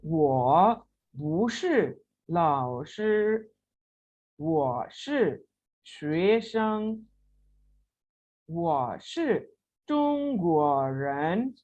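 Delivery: very slow, each syllable spelled out; deliberate drill reading; slow model reading. Shown as a demonstration. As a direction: read it word by word for learners. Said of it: "कैसे gap clear होगा जी?".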